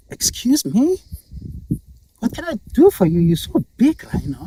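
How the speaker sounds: high, loud, taken aback; incredulous porcupine. Acted. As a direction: question the big rhino in squeaky disbelief. Porcupine astonished by rhino's plea.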